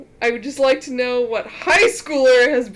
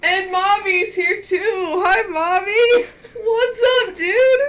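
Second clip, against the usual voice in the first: excited greeting; 9 semitones higher, 4 dB louder, slower than usual. Real, not acted. Greeting viewers joining stream.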